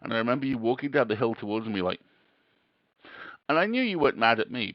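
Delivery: normal talking voice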